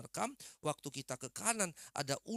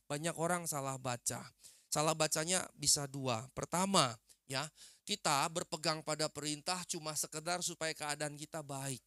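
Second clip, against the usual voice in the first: ordinary talk, nothing marked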